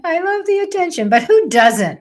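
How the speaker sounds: giddy ham-bone gush; excited. Real, not acted. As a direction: gush about loving attention. Hamming it up after laugh.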